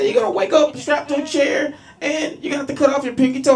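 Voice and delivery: high, loud, excited retelling; hammed-up high-pitched delight; amused girlfriend. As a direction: imitate a girlfriend gleefully relishing a threat. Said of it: "She thinks this is hilarious".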